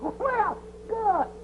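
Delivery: high, soft, drawn-out delight; pleased as a child, high and soft. Acted. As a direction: pitch it up in happy anticipation. Delighted at promised game.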